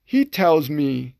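ordinary delivery

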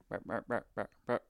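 short, repeated animal-like calls; imitated deer-stopping call. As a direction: mimic a call to halt a deer. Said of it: "just, like, stop".

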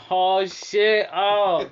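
loud, high, thrilled exclamation; overjoyed at the big bag; amazed happy outburst; delighted surprise. Retold or imitated. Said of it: "and I'm like".

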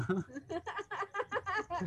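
risa aguda que estalla; reírse sin poder parar; carcajada aguda. Real, not acted. No te rías. (carcajada aguda)

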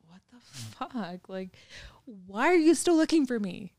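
slow exasperated telling-off of a stalker; angry retort. Retold or imitated. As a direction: lay into him slowly. Retold angry reply to stalker.

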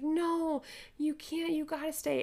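high, insistent protest; pleading friends. Retold or imitated. Friends urging her to stay, pleading. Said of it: "everyone's like".

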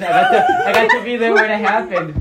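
high-pitched laughing, words bursting out loud. Real, not acted. Friend laughing, wishing he'd witnessed the slip.

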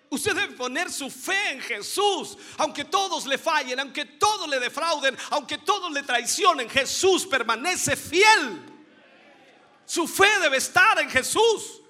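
rising, loud, fervent preaching, slowing on key lines; preaching at full force. Acted. Preacher to the congregation, loud fervent preaching. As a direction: proclaim it like the peak of the sermon. Preacher climaxes on faithfulness of Jesus.

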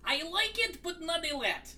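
high, put-on Gru voice; Gru accent. Teased about sounding like Gru, host hams up the Gru accent. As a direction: play Gru with a silly accent. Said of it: "You sound like him".

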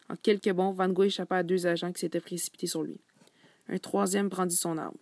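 lively reading of a novel passage with voiced dialogue; story narration. A narrator's reading. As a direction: read the excerpt to the class like a storyteller. Student reads second novel excerpt. (lecture expressive d'un roman à voix haute)

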